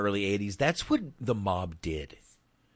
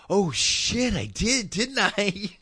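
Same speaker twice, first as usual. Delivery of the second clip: sudden high-pitched oh shit realization; surprised realization; 12 semitones higher, 3 dB louder, much slower than usual. Retold or imitated. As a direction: realize it all at once, half laughing. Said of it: "And I'm like".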